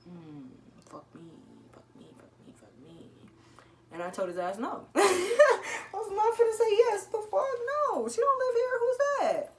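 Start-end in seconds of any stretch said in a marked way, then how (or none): 0.0-3.1 softly cursing to herself, dropping to a voiceless whisper; muttered under breath. Real, not acted. A teen muttering panicked curses to herself at the door. 5.0-9.5 high, loud laughing through the words; laughing while telling. Real, not acted. A teen laughing at her own lie to the cop, high and loud.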